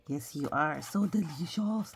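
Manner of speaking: ordinary delivery, nothing marked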